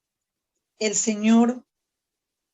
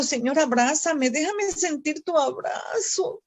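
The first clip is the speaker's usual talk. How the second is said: choking up, voice rising and softening as she pleads; tearful prayer; 4 semitones higher, 4 dB quieter than usual. Retold or imitated. Preacher reenacting her tearful prayer to the Lord, crying. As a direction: pray through tears, begging to be held. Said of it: "y me pongo a llorar".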